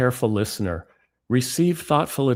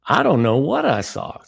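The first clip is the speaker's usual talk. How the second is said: a put-on Southern accent, louder and higher; 5 semitones higher, 5 dB louder than usual. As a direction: impersonate a Southern friend insisting. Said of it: "my bad impression of Ricky".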